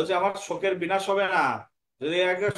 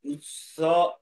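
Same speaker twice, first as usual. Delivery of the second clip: slow, sustained syllables; word-by-word teaching chant; broken into parts; much slower than usual. Shown as a demonstration. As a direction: recite it piece by piece, holding the vowels. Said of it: "ভেঙে ভেঙে নিলাম".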